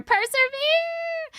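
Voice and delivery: mock rallying cry. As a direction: belt it like a rallying call. Mock cheer reading comment.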